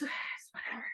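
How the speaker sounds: soft resigned whisper; sighed whisper. Real, not acted. Shrugging off the speculation in a soft sighing whisper. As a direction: let it out on a sigh.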